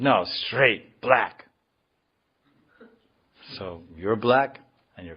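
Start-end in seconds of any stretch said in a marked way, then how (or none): 0.0-1.5 emphatic coffee order, louder on the last word; firm refusal. Acted. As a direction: act out a firm, punched refusal.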